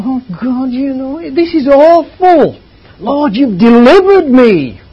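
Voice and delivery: high, loud, slow and soaring; the uplifting take of the verse; lifted, joyful reading. Shown as a demonstration. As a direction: make it soar upward. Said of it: "deliverance is the lifting up".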